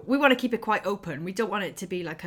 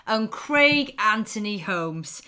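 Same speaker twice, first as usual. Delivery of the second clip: podcast host intro; 5 semitones higher, 6 dB louder, slower than usual. Acted. Show opening announcement.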